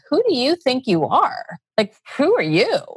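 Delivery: lightly voiced scornful self-doubt; challenging inner critic. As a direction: question them as their inner resistance would. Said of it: "resistance part that's saying".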